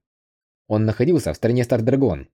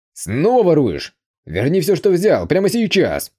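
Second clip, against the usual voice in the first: slow, higher, louder telling-off; loud yelling; 6 semitones higher than usual. A narrator's reading. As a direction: yell at him to give it back. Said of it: "громко закричал ему он".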